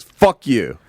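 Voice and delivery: quick retort snapped straight back; boomerang quick. Retold or imitated. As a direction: imitate an instant comeback. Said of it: "It was boomerang quick".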